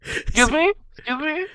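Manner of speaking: high imitation of the rude rider; mock high excuse me. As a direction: squeak it high.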